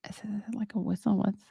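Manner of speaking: whispered aside to herself; quick whispered aside while checking something. Real, not acted. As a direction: breathe the question with no voice. Muttering aside off-mic.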